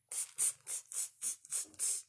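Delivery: unvoiced "tss" monkey clicks; monkey chatter. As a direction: chitter back like cheeky monkeys with short tss sounds. Monkeys answering the peddler.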